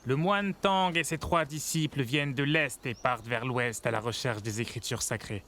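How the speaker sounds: rousing street storyteller building up the heroes; storyteller's tale. Acted. Storyteller on the corner thrilling his listeners, grand rousing narration. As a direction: sell the legend to the crowd, rising to a proud finish.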